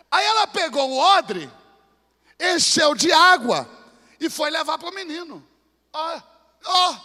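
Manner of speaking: loud shouted preaching; preaching at full force. Acted. Preacher to congregation, loud shouted climax. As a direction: drive the story home with force.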